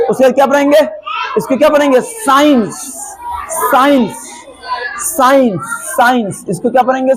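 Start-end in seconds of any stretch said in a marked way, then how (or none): 2.0-6.5 "साइंस" starting on a single s; silent c. Shown as a demonstration. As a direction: repeat "साइंस" as separate falling models. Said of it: "सी silent रहेगा".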